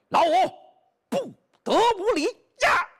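long, high stern call from the doorway; loud shout. Acted. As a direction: eldest brother shouting from the door to halt the fight. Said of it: "有人大喊一声".

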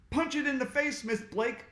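high urgent call; shout. A narrator's reading. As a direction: urge her on. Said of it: "shouted Ryan".

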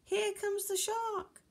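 high-pitched anxious cry; high, worried alarm without shouting; flustered fishes. Acted. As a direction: fret it in a high voice. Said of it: "All of the fishes were flustered".

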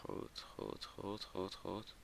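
very soft repeated mutter; quiet self-reassurance. Real, not acted. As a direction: murmur it over and over, barely voiced. Careful moment in game, self-muttering. (heel zacht gemompel)

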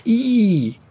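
very high, long "eee"; high wail. A narrator's reading. Sleeper tormented by nightmare, high keening wail. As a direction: let a keening note escape. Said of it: "Le son s'échappa de ses lèvres".

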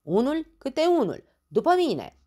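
loud, high marching orders; shouted. Acted. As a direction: command them to follow. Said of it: "strigă el". (comandă strigată, tare și înalt)

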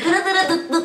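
high vocalised dance beat; mock party tune. Vlogger teasing a party-going friend, chanted disco beat. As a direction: chant a little disco riff.

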